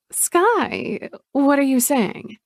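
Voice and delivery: a falsely innocent sister brushing it off; Chloe's voice. Acted.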